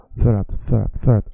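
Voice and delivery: för att run together as one word, R almost silent; för att slurred into fəɹæt; linked för att. Shown as a demonstration. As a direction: join för and att with barely any R. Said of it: "how I connect för and att".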